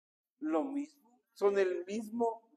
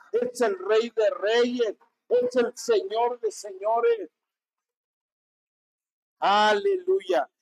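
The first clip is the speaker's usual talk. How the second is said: más aguda y lenta, cada palabra clavada; proclámalo con fervor creciente; proclamación exaltada; 7 semitones higher, slower than usual. Real, not acted. Predicador proclama a Cristo Rey. (predicando a la congregación, proclamación exaltada y lenta)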